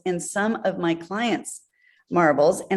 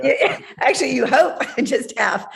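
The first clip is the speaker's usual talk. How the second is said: laughing; 5 semitones higher than usual. Real, not acted. Joking about media costs.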